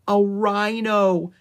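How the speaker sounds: slow, loud, high stage-hushed amazement; amazed exclamation. A narrator's reading. Annie sees a rhino come from the thicket, amazed exclamation. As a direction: draw it out in wonder.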